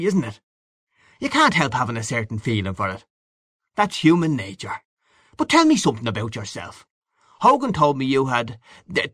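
hearty boastful Gallagher figure. Acted. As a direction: boast like a worldly old pal, full of relish. Gallagher chats to Chandler.

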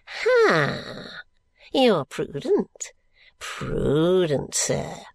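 put-on high, slow cackling old man; old Smallweed crying out. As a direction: cry it out high like a gleeful miser. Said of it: "cries Grandfather Smallweed".

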